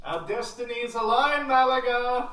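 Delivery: loud robotic proclamation; robot voice yelling. Acted. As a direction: boom it out like a robot. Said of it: "yelling at you in a robot voice".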